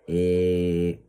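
loud drawn-out eh; hesitation filler. Shown as a demonstration. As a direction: fill the gap with a long eh. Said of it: "so you don't go".

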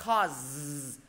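"cause" slowly, with a long buzzing z; teacher drawing out the final z in "cause"; final z sound. Shown as a demonstration. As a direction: hold the voiced z ending of "cause". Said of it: "cause âm cuối cùng là âm giờ".

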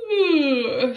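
loud mock moo; loud silly moo. The tired cat answering the mouse with a loud moo. Cat's wrong sound.

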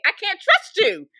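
high, loud, slow mock distrust; hammed-up shriek of disbelief; mock exasperated outburst. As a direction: wail it out like a betrayed diva. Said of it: "I'm like".

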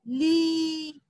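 ली with the ई held long; drawn-out vowel. Shown as a demonstration. Teacher modelling a long ली for her class. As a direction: pull ली out long and steady. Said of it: "लंबा खींचो बच्चों".

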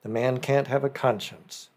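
ordinary delivery, nothing marked

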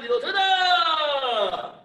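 very slow, drawn-out count call; sharp martial arts commands. Real, not acted. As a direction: stretch the count out long. Instructor calling the kick count.

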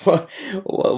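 normal talking voice

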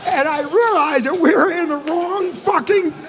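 exasperated storytelling climax; incredulous outburst. Retold or imitated. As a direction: blurt the awful realization at full volume. Comic outburst at story's reveal.

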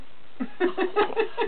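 breathy wheezing laugh. Real, not acted. Laughing at the punchline.

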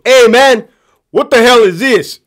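loud, high, indignant retort; mock indignant challenge. Captain B challenges Captain C before the class, loud mock indignation. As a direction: imitate an outraged captain calling out a colleague.

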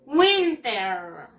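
WINTER drawn out, falling at the end; model pronunciation. Shown as a demonstration. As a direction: say "Winter" slowly for pupils to copy. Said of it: "Let's practice again the pronunciation". (pronunciación modelo, alargada)

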